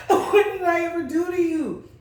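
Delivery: high, whiny and laughing; mocking rival's whine. Imitating the rival's repeated plea at the checkout, high and laughing. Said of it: "she was like".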